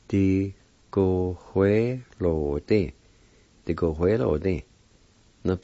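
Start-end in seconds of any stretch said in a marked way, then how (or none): 0.1-2.9 "Di, go, hue, lo, di" with short gaps; measured "Di, go, hue, lo, di", syllables separated; broken into syllables. Shown as a demonstration. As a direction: sound out "Di, go, hue, lo, di" part by part. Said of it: "It's pronounced like this".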